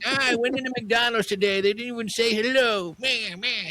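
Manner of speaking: high-pitched hammed-up old grouch complaining; mock yourself as a cranky old man, voice pushed way up; grumpy old guy. Host parodying his own customer service rants, grumpy old guy. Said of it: "I just sounded like a grumpy old guy".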